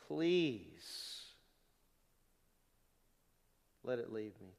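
low, soft, halting plea; begging. Acted. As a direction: plead quietly with long pauses. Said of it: "Paul begged him and said".

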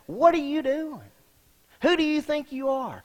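mocking critics. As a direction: sneer it up high like a heckler. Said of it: "people that ridicule you and say".

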